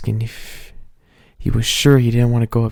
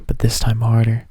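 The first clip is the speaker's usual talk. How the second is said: ordinary delivery, nothing marked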